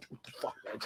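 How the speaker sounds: barely voiced, very soft; mock bewilderment under the breath; baffled under the breath. Stranded rider watching the bus not stop, baffled under the breath.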